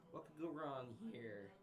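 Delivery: slow, voice cracking upward. Real, not acted. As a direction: let the voice crack on it. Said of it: "That was an amazing voice crack".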